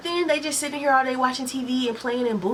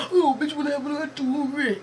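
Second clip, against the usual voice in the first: mocking rude customer; 5 semitones higher, slower than usual. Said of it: "still talking shit like".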